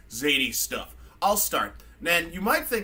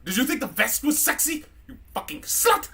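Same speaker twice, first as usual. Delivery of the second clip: put-on high, loud, catty drunken British robot; mean drunk rant, building to an outburst; drunk C-3PO; 4 semitones higher, 4 dB louder than usual. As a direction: sneer accusations like a sloshed British droid. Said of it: "three P O's just a mean fucking drunk".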